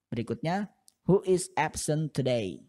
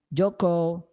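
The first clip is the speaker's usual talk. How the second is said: higher, calling out a name like a teacher at roll call; teacher taking roll; 5 semitones higher, 3 dB louder, slower than usual. Shown as a demonstration. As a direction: call Joko's name across the class. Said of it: "ketika guru sedang mengabsen". (guru memanggil nama saat absen)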